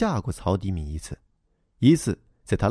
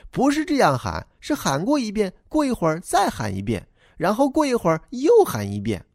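put-on high, childlike voice for the little boy; young boy replying to the grown-up, high and eager; boy's voice; 11 semitones higher than usual. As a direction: play the earnest schoolboy explaining himself. Boy explains his calling.

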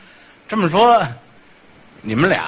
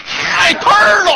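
grido trionfante, molto più acuto e forte, lento; triumphant shout; 8 semitones higher, 5 dB louder, slower than usual. Acted. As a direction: canzona il compagno che non sa rispondere. Mocks partner stumped.

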